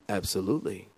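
low, soft confident reply; phone voice. Retold or imitated. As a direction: answer quietly like on the phone. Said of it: "And I said to her".

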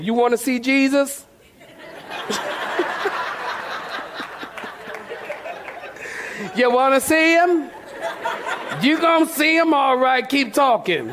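laughing mock threat; threatening mother. Mother daring her child in front of the church. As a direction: dare the child to keep going. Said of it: "She tell you in a minute".

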